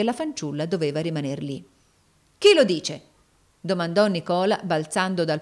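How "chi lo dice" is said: loud, indignant demand; a burst of loud defiance; sharp challenge. A narrator's reading. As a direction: challenge them angrily, voice raised. Hero challenges two villains.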